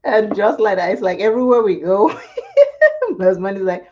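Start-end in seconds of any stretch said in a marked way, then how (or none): 2.1-3.1 squealing laugh; high burst of laughter. Real, not acted. Laughing while telling viewers about husband. Laughing over people being drawn in.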